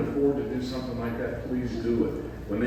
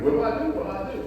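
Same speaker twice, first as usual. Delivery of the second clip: panicked; 12 semitones higher, much faster than usual. Retold or imitated. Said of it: "And wondering".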